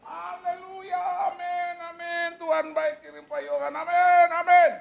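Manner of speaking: teriak haleluya. Retold or imitated. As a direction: cry out very high, ending in loud amens. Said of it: "Dia teriak gitu". (meniru sorak bernada tinggi, makin keras)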